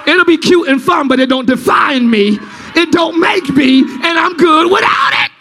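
loud, high, hammered-out preaching; shouting sermon climax; full-force preaching. Acted. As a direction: preach it hard over the music.

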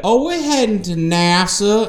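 high, slow drawn-out put-on voice; imitating movie character. Film character answering a cop, high imitated voice. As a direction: pitch it way up and stretch it out. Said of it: "The cop's like, "Where y'all headed?".

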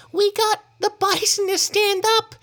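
put-on high-pitched little girl voice; small girl. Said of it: "said a small girl who was in the group".

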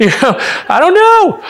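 high-pitched sheepish squeak; squeaky high falsetto. Real, not acted. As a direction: jump way up into a squeal. Embarrassed at his own suggestion.

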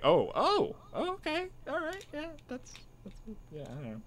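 high, fading to a breathless hush; stunned confusion. Retold or imitated. Viewer hearing the Greek accent, stunned, high and fading. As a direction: rise in disbelief then trail off almost unvoiced. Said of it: "I was just like".

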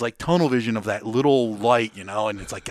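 normal talking voice

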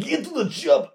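high Austrian action-hero shout; lightly voiced Austrian tough guy; Schwarzenegger impression. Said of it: "that's Arnold Schwarzenegger".

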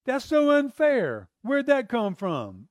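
high, slow cry of disbelief; shocked protest. Retold or imitated. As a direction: gasp at the injustice, drawn out. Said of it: "You're gonna go".

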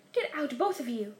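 plain reading voice

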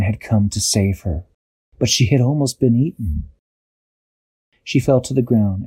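ordinary delivery

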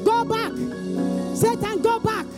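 preaching at full force. Real, not acted. Full-force declarations against Ebola.

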